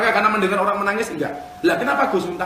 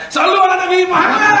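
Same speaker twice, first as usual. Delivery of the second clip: loud, high Maulid chant-leader's shout; bawling; 12 semitones higher, 9 dB louder than usual. Acted. Imitating someone yelling at a Maulid celebration, bawling. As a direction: bellow it high over the crowd. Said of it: "bengok-bengok mentang-mentang Maulid Nabi".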